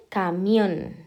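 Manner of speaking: caMIÓN, the final syllable held and strong; stress on last syllable. Shown as a demonstration. Teacher marking the tonic syllable, Camión stressed on MIÓN. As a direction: lean hard on MIÓN in Camión. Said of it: "subrayar la sílaba tónica".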